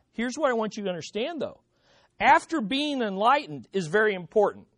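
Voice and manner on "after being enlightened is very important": usual speaking voice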